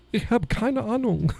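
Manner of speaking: high put-on voice saying "Ich hab keine Ahnung"; mock German voice. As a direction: pitch it up like a clueless local.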